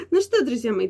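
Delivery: ordinary talk, nothing marked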